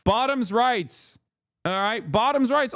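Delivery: high, drawn-out slogan; indignant rallying cry. Real, not acted.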